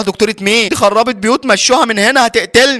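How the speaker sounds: high-pitched, acted panic; alarmed protest. Patient begging staff to remove the surgeon, alarmed.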